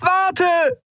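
very high pleading cry; desperate scream. A narrator's reading. Andriel begs Keru to wait, screaming. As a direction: cry out high to hold them back. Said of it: "schrie sie".

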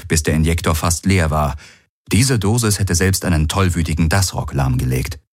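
usual speaking voice

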